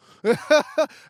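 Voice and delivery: bursts out laughing, high and loud; a big laugh at his own threat. Real, not acted. Laughing at his own threat to the cop, high and loud.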